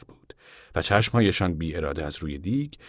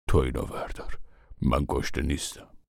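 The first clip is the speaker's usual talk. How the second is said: lower, softer uncle voice; lightly voiced uncle; 5 semitones lower, 4 dB quieter than usual. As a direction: push the plate away quietly. Uncle John declines food. (صدای آرام و بم عمو)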